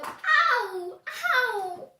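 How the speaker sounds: loud, high ow; acted pain cry. Cat punched by super dog, loud hurt yelps. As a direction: yelp in play-acted pain.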